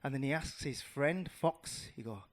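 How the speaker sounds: usual speaking voice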